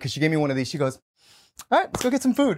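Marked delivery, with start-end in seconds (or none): 1.7-2.6 higher, a touch disappointed; mock resigned girl. Imitating Brittany settling for food, higher. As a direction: let the offer drop with a sigh.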